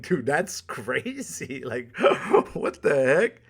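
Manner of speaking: slow words broken by laughs; laughing disbelief. Real, not acted. Laughing at a date who vanished, amused disbelief. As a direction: laugh through the words at how absurd it was. Laughing about being ghosted.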